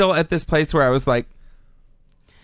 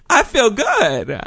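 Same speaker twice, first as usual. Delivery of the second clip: exaggerated elation; 5 semitones higher, 3 dB louder than usual. Acting out groggy post-surgery elation.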